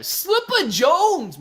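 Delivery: loud, high, drawn-out call; hyped shout-out. Real, not acted. Streamer greeting a chatter, hyped shout-out. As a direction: holler the name slowly and high.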